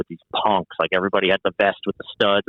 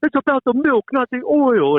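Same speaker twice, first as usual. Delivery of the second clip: much higher, lecturing Jersey pizza owner; Guido guy; 3 dB louder than usual. Retold or imitated. Owner lectures the visitor on dough: high mock Guido voice. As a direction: preach it like a brash Guido pizza man. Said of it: "the ultimate Guido guy".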